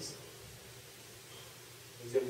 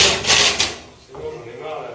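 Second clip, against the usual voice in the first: very loud burst, dropping off after; angry outburst; 16 dB louder, much slower than usual. Acted. Son has had enough of being criticised, angry outburst. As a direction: cut them off with a fed-up shout. Son explodes in family quarrel.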